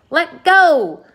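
loud, high shout. A narrator's reading. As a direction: yell it out sharply, long on the last word. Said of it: "shouted the centipede".

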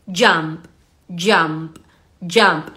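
"jump" low, with the final p popped; sounded final P. Shown as a demonstration. Teacher drilling the word for learners, final P sounded. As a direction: drop the pitch and release the p in "jump". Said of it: "la P final que suene".

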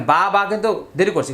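normal talking voice

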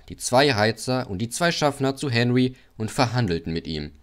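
ordinary delivery

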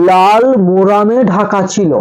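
slow, word by word for note-taking; slow dictation. Shown as a demonstration. Teacher dictating the answer to students, slowly and clearly. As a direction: spell it out slowly so students can write. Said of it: "আমি আস্তে আস্তে বলছি".

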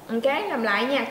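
usual speaking voice

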